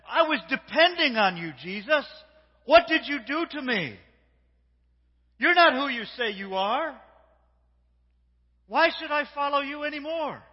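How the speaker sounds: loud, high, betrayed outcry; acted accusation. Acts disillusioned follower accusing Jesus.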